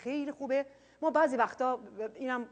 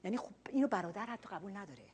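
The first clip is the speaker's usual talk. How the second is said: ordinary delivery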